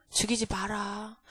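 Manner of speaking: a low, slow, hushed "don't kill him"; David's quiet command. Acted. King softly stopping his servant, hushed and slow. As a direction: hold the servant back gently and slowly.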